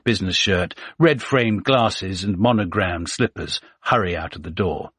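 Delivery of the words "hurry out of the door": ordinary delivery, nothing marked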